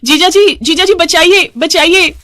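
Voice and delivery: loud, high, repeated cries; shouting for help. Acted. Sister-in-law still calling from the bathroom, shouting for help. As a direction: scream out to be saved. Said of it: "Dimple अब भी चिल्ला रही थी".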